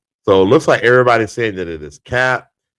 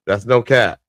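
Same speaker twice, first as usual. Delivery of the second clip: normal talking voice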